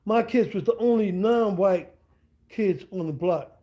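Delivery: slow down and drive the point home; slow emphatic repeat. Real, not acted. Repeating it slowly so listeners catch it. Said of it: "You heard I said that?".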